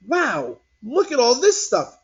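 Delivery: put-on high, loud thrilled kid; a boy amazed by the toys; excited exclaim. A narrator's reading. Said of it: "exclaimed Larry".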